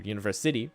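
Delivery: "University" stressed oddly late, on SI; stress on SITY. Shown as a demonstration. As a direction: shift the weight of "University" to SITY. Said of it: "That sounds weird too".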